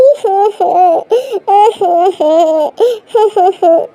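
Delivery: put-on a child's laugh that turns into crying; playful laugh that breaks into a sob; childish laugh-cry. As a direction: wail and giggle like a small child.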